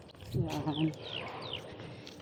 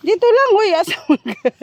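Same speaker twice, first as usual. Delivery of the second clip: calling out; 11 semitones higher, 5 dB louder than usual. Real, not acted. Calling out to child.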